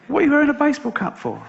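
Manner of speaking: high, fast teasing voice; mocking spiders. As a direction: jeer like a schoolyard gang. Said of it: "all the other spiders go".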